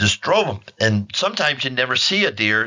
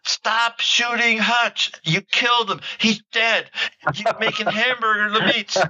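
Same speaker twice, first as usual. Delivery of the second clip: laughing buddy's plea; 12 semitones higher than usual. Retold or imitated. Buddy to trigger-happy hunter over the dead deer, high mock plea. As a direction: imitate an amused friend begging him to quit. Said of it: "and Dick is laughing".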